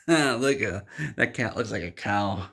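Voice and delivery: acted laugh breaking into the words. Half cat, half man spots a cat, laughing. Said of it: "he just starts laughing and laughing".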